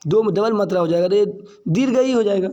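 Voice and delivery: usual speaking voice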